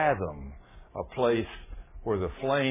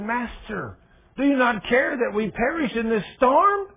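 much higher, louder frightened plea; panicked cry; 11 semitones higher, 6 dB louder than usual. Acted. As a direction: shout over the storm in fear. Disciples waking Jesus in storm.

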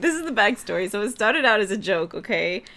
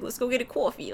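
put-on playful Boston accent, a bit higher; 4 semitones higher, 3 dB quieter than usual. Inviting a friend for coffee, Boston accent. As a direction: put on Boston vowels for a joke. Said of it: "we, we talk like this".

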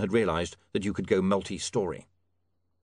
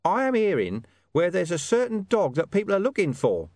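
high, louder-than-narration informant voice; mystery informant; 8 semitones higher, 3 dB louder than usual. Acted. Hidden informant tipping William off about a dog, high and conspiratorial. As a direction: drop the hint from the shadows, pitched up.

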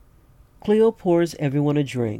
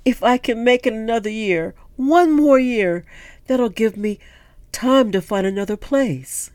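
higher, pleading character voice; 9 semitones higher, 4 dB louder than usual. Acted. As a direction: raise the voice to a hopeful plea.

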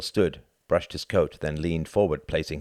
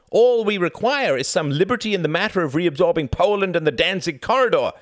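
higher, clipped-free insistent diplomat pleading; German envoy voice; 11 semitones higher, 5 dB louder than usual. A narrator's reading. Envoy courting Churchill, higher and louder persuasion. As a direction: press the offer as a smooth German envoy. Ribbentrop voiced in read story.

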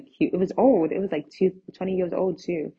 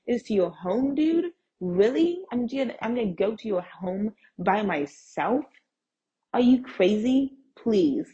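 incredulous questioning; 3 semitones higher than usual. Retold or imitated. Challenging seller about visiting his home alone, incredulous. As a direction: voice jumping high in disbelief.

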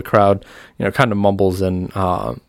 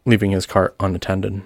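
normal talking voice

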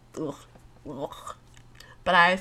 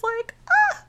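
cooing. Real, not acted. Cooing over babies on trip.

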